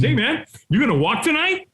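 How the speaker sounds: high, casual-sounding question; imitated friendly stranger. Firewalk helper to hiding man, imitated friendly probing. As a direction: pitch it up as an inquiring helper.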